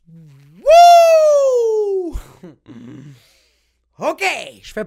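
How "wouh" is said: high, loud whooping yell. Acted. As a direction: let out a big high whoop. Reaction to grandma's insult. (grand cri aigu)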